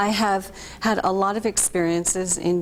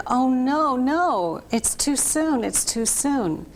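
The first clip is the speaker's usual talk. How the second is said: tearful plea; 5 semitones higher than usual. Retold or imitated. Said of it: "tears just came out of my eyes".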